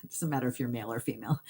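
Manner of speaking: ordinary talk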